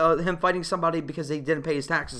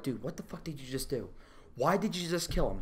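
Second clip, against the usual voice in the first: much softer and lower, under the breath; soft, low retold disbelief; stunned hush; 7 semitones lower, 12 dB quieter than usual. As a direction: mutter it in disbelief. Own stunned reaction in theater.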